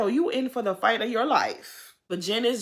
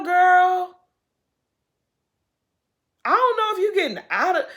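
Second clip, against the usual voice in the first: raised, loud alarmed plea; practically shouting at her about jail; high, loud worried outburst; 11 semitones higher, 8 dB louder than usual. Real, not acted. Alarmed about Jen going to jail.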